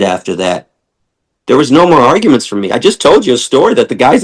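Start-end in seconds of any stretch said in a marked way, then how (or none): none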